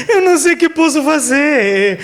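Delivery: high voice with laughter; mock helplessness. Preacher jokes he cannot help in the storm, laughing mock helplessness. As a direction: laugh through a playful helpless plea. Jokingly acts his helpless reply.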